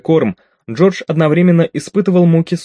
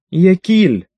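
higher-pitched excited cry of recognition; exclaimed; 6 semitones higher, 4 dB louder than usual. A narrator's reading. Skvi spots the long-missed food, exclaiming. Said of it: "воскликнула она".

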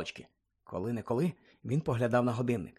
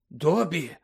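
usual speaking voice

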